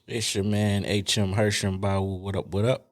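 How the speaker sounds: normal talking voice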